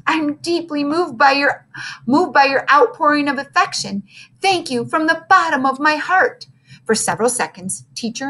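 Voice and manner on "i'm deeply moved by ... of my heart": sniffling. Acted. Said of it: "he managed to sniffle".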